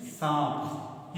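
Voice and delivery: सांप drawn out slowly with a nasal vowel; slow demo word. Shown as a demonstration. Teacher to class, slowly demonstrating the nasal vowel. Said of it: "क्या लिखें".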